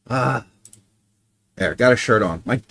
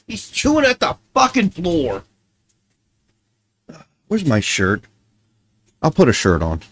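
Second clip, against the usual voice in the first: ordinary talk, nothing marked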